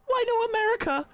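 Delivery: very high, loud shout; playful high-pitched outcry; mock outraged shout. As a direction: yell it like a mock protest. Shouts out reading chat.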